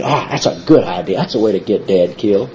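a hint of a scheming son, voiced low and soft; lightly voiced rebel son plotting against his father; Absalom voiced scheming. Preacher voicing Absalom plotting his father's death, lightly scheming.

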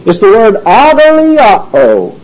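"agaliao" said slowly, each syllable clear; Greek word agaliao. Shown as a demonstration. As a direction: sound out "agaliao" carefully and slowly. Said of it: "it's not the word kairo".

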